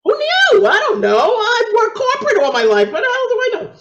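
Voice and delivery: high-pitched, half-laughing shout of protest; mock-indignant squawk, high and loud; exasperated protest. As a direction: plead ignorance loudly, voice shooting way up. Acted defensive outburst in retold argument.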